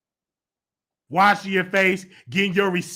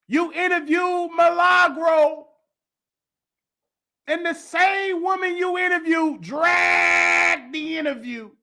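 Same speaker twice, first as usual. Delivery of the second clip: calling out; 7 semitones higher, slower than usual. Real, not acted. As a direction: shout him down, slowly. Calling out Armand directly.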